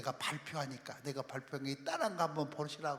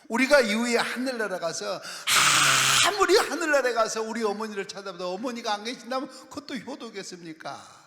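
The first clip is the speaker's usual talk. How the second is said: raised, forceful sermon delivery with loud bursts; impassioned preaching; 7 semitones higher, 13 dB louder than usual. Real, not acted. Preacher urging the congregation to save their parents' souls, impassioned preaching. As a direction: drive the questions home, then declare it loudly.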